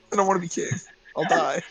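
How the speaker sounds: ordinary talk, nothing marked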